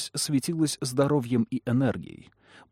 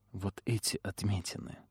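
tense, hushed warning; 2 semitones lower, 10 dB quieter than usual. A narrator's reading. Trish confronting Lamia, frightened.

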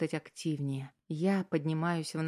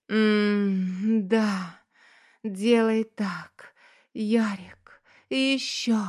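moaning, high and drawn-out; breathless moaning; 4 semitones higher, 4 dB louder, much slower than usual. Acted. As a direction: moan the words, slow and high. Lovers overheard behind door.

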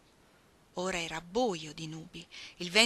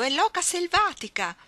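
excited cry; 10 semitones higher, 7 dB louder than usual. Acted. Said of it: "gridò Orlando".

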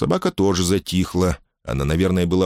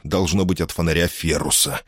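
ordinary delivery, nothing marked

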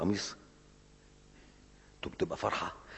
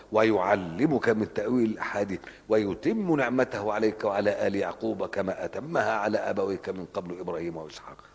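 Quran recitation; 5 dB louder than usual. Acted. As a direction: chant the verse with conviction.

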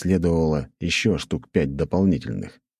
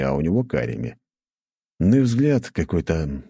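ordinary delivery, nothing marked

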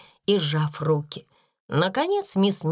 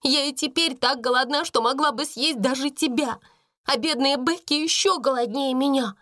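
high-pitched emotional outburst; distressed girl's confession; exclaimed; 6 semitones higher than usual. A narrator's reading. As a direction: let restraint go and blurt it out. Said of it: "воскликнула она".

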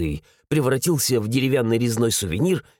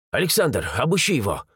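ordinary delivery

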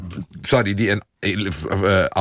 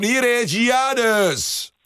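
announcer voice; 13 semitones higher, much slower than usual. Real, not acted. Host introduces the guest.

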